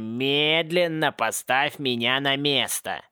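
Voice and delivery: drawn-out, spelled-out slow order; slow and deliberate. Acted. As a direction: stretch every word like talking down to a child. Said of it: "то есть наоборот, медленно". (медленно, с нажимом, растягивая слова)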